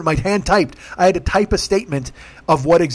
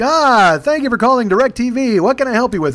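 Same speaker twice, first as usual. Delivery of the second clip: cheery agent; 6 semitones higher than usual. Retold or imitated. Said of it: "he's like".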